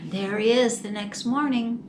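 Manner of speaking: ordinary delivery, nothing marked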